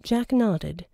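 usual speaking voice

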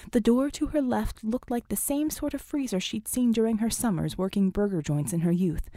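ordinary delivery

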